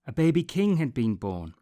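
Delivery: ordinary delivery, nothing marked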